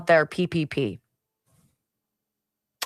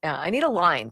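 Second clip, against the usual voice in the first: ordinary talk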